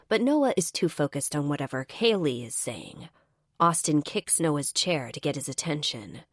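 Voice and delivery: ordinary delivery, nothing marked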